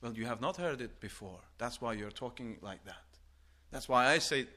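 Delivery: ordinary talk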